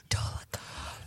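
hushed mock-spooky whisper. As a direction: breathe the name in a hush. Whispering the feared name.

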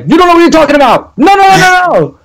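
high, fast mock heckler voice; squeaky imitation of angry replies; mocking haters. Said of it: "people coming at me".

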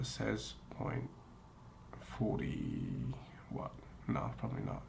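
barely voiced whisper; quiet at night. Real, not acted. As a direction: whisper so the dog sleeps. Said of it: "my voice is getting low and lower because".

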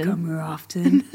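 low, lightly deep mock chat-up; a hint of deep seductive voice; deep pickup line. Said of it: "Really deep".

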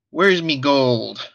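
put-on slow Irish leprechaun accent, drawn out; a playful Irish accent, like a leprechaun. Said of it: "It's not a bad impression".

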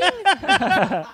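high burst of laughter. Real, not acted. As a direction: laugh at your own story. Laughing at own story.

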